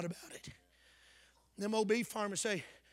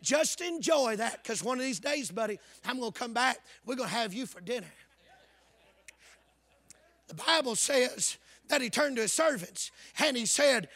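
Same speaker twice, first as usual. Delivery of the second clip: ordinary talk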